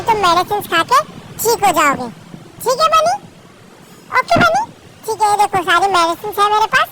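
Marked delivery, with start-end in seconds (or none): 2.6-4.6 high, sing-song coaxing of a pretend patient; little doctor coaxing her toy bunny, high and sweet.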